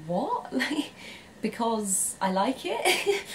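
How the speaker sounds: soft, taken-aback reply with a laugh, rising at the end; retold mild shock. Explaining a funky jacket to a blunt friend, mildly shocked. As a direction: act out being quietly, amusedly taken aback. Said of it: "I was quite shocked".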